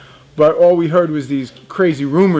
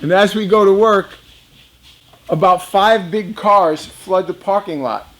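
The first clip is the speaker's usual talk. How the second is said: ordinary delivery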